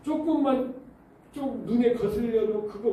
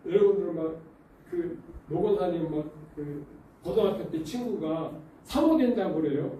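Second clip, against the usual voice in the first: ordinary talk, nothing marked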